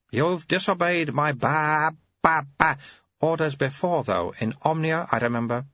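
put-on very high, babyish old abbot voice; an ancient mind in a baby's mouth; high piping infant abbot; baby-bodied old abbot. Abbot recalls disobedience.

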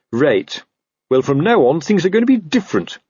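self-important officer laying down the law; lightly voiced blustering boss; officious captain. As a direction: announce it with puffed-up authority. New captain lays down law.

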